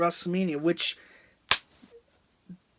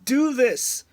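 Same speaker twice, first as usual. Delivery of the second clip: higher, louder mock desperate plea; imitated frantic begging, pitched up; pleading Bray Wyatt; 5 semitones higher, 6 dB louder than usual. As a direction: act out a wrestler begging his rival. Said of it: "you can hear Bray Wyatt say".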